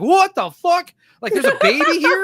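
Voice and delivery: high, loud disbelieving outburst; shocked audience. Retold or imitated. The whole audience reacting to a crying baby, shocked and high. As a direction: sound stunned at a baby in the theater. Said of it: "The whole audience is like".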